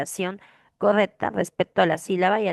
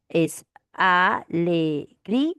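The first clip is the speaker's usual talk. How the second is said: drawn-out syllables; much slower than usual. Shown as a demonstration. Teacher to students, slowly sounding out alegría. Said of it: "teacher syllabifies word slowly".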